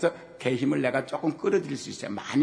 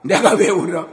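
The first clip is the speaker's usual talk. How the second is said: cocky son; 6 semitones higher, 11 dB louder than usual. Retold or imitated. Son boasting before wrestling.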